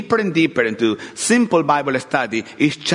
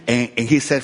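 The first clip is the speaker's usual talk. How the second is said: ordinary talk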